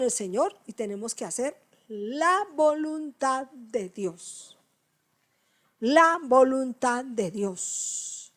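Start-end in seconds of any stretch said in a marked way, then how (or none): 1.9-4.6 "voluntad de Dios" drawn out slowly; slow emphasis. Shown as a demonstration. Recalca despacio. 5.8-8.3 "La voluntad de Dios" stretched slowly; slow repeat. Shown as a demonstration. Repite despacio.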